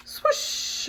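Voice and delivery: whispery rush of air; voiceless swooping sound; swoosh. Acted. Seagull sound effect.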